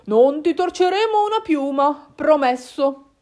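hearty fox father giving his word; promising father fox. Acted. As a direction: swear it emphatically. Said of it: "E Papà Volpe aggiunse".

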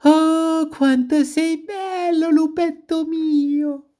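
very high, drawn-out, swooning coo; cooing she-wolves. A narrator's reading. She-wolves circling the wolf at his ear, adoring coo. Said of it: "sussurrandogli all'orecchio".

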